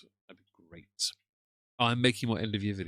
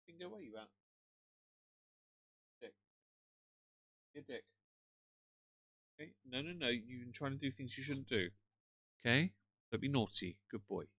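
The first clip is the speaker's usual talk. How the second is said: talking to a pet; 3 semitones higher, 11 dB quieter than usual. Real, not acted.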